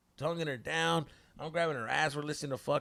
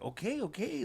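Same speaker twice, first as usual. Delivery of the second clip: meek placating okay; 7 semitones higher, 5 dB quieter than usual. Retold or imitated. Scared son placates angry dad.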